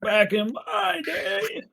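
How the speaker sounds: put-on slow, high creaky old-timer; old-timey voice. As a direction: drag it out like a grumpy grandpa. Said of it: "they're saying it in an old-timey voice".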